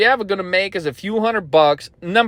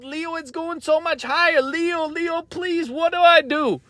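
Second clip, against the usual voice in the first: high, whiny begging in mock panic; frantic, pitched-up mock panic; panicked trader; 7 semitones higher than usual. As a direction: play the scared trader pleading with Leo. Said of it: "what do you wanna do?".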